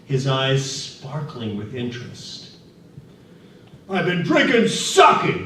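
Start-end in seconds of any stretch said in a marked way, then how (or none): 3.9-5.4 put-on bellowing drunk. A narrator's reading. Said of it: "the laborer bellowed back".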